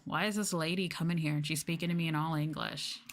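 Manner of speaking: ordinary talk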